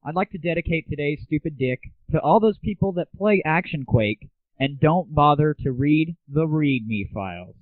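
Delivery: ordinary talk, nothing marked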